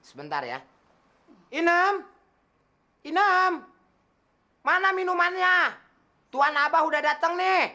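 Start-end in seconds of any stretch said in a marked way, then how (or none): 1.5-7.7 loud, high call to the kitchen; calling the maid. Acted. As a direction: summon the maid impatiently. (majikan memanggil pembantu)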